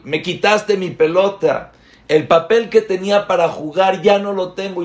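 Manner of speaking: a hint of a whiny little boy protesting; upset child. Little boy to brother who burned his paper ball, lightly voiced whiny protest.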